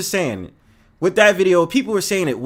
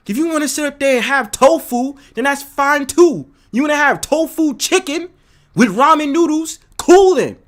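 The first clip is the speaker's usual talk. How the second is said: climbing higher and louder to a shout; escalating rant; 8 semitones higher, 6 dB louder than usual. Real, not acted. Mocking vegan dates, rising to a shout. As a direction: build up to an exasperated cool then. Ranting about tofu dates.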